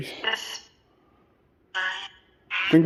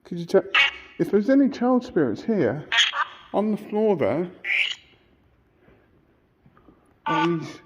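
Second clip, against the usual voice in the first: higher, gentle coaxing; 6 semitones higher, slower than usual. Real, not acted. Addressing child spirits kindly.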